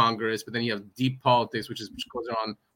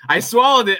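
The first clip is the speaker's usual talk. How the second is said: louder and higher, disbelief at himself; emphatic exclamation; 10 semitones higher, 13 dB louder than usual. Retold or imitated. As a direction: punch it out in mock horror. Emphatic repeat of swallowing.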